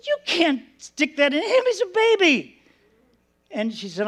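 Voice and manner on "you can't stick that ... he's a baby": high-pitched retold objection; mock-panicked pleading with a nurse; alarmed protest. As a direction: protest like an alarmed young parent.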